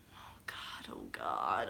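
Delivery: whispered panicked "oh God"; dread whisper. Real, not acted. Moviegoer watching Star-Lord lose it, frightened whisper. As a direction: breathe it in growing dread.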